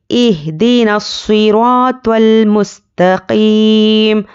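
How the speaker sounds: slow, drawn-out recital, المستقیم closed on a still m; recitation stopping on meem. Shown as a demonstration. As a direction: stretch the long vowels, end المستقیم on vowelless m. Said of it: "میم پر جزم دے کر سانس توڑیں گے".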